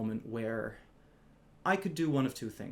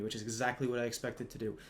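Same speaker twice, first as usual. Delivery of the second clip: normal talking voice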